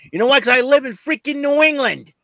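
loud, high, indignant outburst; mock rant. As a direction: rant it as a comic grievance. Mock rant about living in New England.

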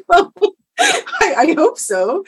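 high, loud and laughing; amused, laughter-broken retort; laughing reply. Retold or imitated. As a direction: burst out laughing through the words. Retold reply amid her own laughter.

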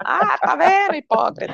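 high-pitched mock accusation; gloating commenter. Followers calling out the host in comments, mock-accusing. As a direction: imitate an online commenter crowing at catching them out. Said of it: "e comentar lá".

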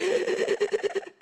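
high crying intake of breath; crying gasp; sob gasp. Retold or imitated. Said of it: "when I'm crying".

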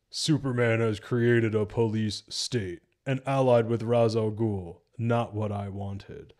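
slow, stilted line reading; mock a wooden delivery, slowly; badly delivered Batman. Reviewer quoting Batman talking to Selina, stilted and slow. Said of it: "the way he delivers the dialogue is so bad".

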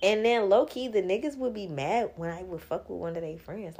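normal talking voice

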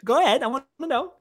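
excited. Retold or imitated. Retold excited reaction to scene.

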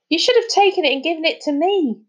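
high-pitched bitter reproach; aggrieved kid's protest. A narrator's reading. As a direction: complain as if cheated. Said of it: "Charlie said bitterly".

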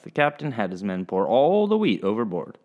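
ordinary delivery, nothing marked

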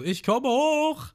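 very high, slow call; shouted reply. Retold or imitated. Ruffy answering Genzo from below, high slow shout. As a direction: stretch it out like a joyful yell.

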